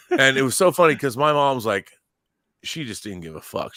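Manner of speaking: normal talking voice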